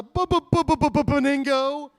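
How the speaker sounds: high, very loud stuttered name; mock stutter. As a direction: stammer repeatedly on the "buh" as if stuck. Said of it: "Sounds like he's got a stuttering problem".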